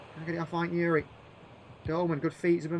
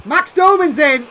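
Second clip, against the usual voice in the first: loud, slow rising call of the chance; excited call; 6 semitones higher, 11 dB louder than usual. Real, not acted. Gamer calling his striker through, excited loud call. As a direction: call him through on goal with a jump in the voice.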